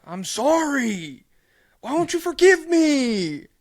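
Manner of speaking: high, slow, pleading mock whine; mocking a player begging forgiveness; whiny apology. Podcaster mocking a player begging to be forgiven, whiny pleading. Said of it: "the Jordan Poole thing where he was like".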